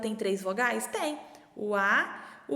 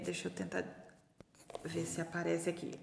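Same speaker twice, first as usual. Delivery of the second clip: soft whispered aside while writing; whispering to herself; 7 semitones lower, 12 dB quieter, faster than usual. Real, not acted. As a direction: whisper it to yourself while concentrating. Muttering while writing upside down. (sussurrando para si mesma)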